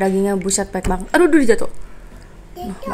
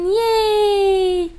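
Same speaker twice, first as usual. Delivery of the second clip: excited cheer; 8 semitones higher than usual. Real, not acted. Cheering before eating.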